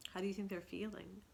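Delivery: usual speaking voice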